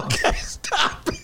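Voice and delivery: giggling high stop it; laughing plea. Real, not acted. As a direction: laugh through the words. Laughing at friend's teasing.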